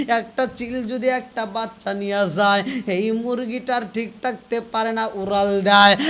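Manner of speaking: ordinary delivery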